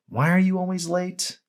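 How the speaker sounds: a light jab from a smug coworker; mocking coworker. Coworkers' remarks voiced.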